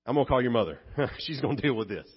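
God as a dad calling for mom, laughing; mock God passing the buck. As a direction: hand the mess off with a chuckle. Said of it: "God said".

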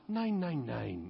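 soft, fading "nine, nine, nine"; low-key placid. Shown as a demonstration. Pastor showing a gentle German no, softly. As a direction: murmur it gently, almost trailing off. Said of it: "low-key, placid".

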